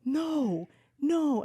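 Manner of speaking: a lightly imitated panicked no; mock pleading. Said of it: "He was like".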